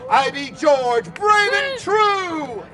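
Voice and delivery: slow, high heroic proclamation. Acted. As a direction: declare it like a bold hero. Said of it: "And he did say".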